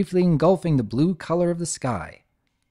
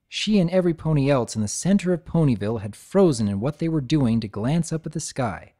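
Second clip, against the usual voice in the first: ordinary delivery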